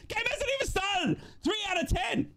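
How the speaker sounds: mock outraged shout. Mock outrage at super chat tally.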